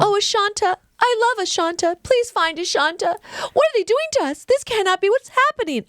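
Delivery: high, hammed-up mock pleading; bad dubbed mother. Mother pleading for her missing daughter, mocked in a high bad-dub voice. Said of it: "The mother's voice is so bad".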